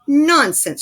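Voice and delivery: loud, high indignant "Nonsense!"; loud, high, outraged royal shout; shouting prince. A narrator's reading. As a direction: scoff it out loud like a spoiled prince. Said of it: "shouted the prince".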